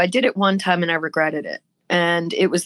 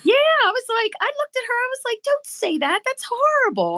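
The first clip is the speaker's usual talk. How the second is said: high, appalled scolding; aghast, voice shot up high; high shocked don't say that. Retold or imitated. Retelling shock at rude friend.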